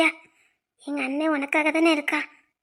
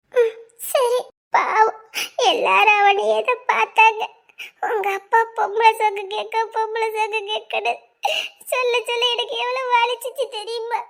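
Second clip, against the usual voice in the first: higher, crying voice; tearful pity; 4 semitones higher than usual. Acted. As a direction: lament his hurt through tears. Girl tearful over his humiliation.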